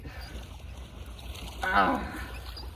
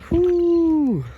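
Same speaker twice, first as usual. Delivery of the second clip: high loud cheer; excited whoop; 12 semitones higher, 7 dB louder than usual. Real, not acted. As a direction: whoop with joy. Cheering the catch.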